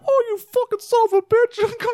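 high squeaky furious cartoon voice. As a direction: squeal it like an angry cartoon character. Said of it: "Is that the one that's like".

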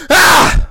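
sudden full loud yell; loud noise. Shown as a demonstration. Shouting to mark the audio for editing. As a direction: let out one big shout for the mic. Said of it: "let me make a loud noise".

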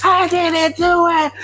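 high, slow wailing yell. Retold or imitated. Hog-tied inmate yelling at the guards, high and slow. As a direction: howl your innocence. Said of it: "He's yelling this shit".

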